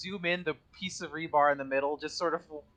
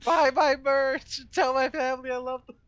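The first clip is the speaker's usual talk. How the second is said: wounded soldier gasping; 10 semitones higher, 4 dB louder than usual. Pretend. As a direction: gasp out final words like a fallen soldier. Mock dying last words.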